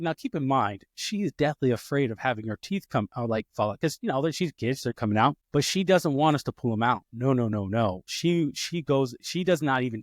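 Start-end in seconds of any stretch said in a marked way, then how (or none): none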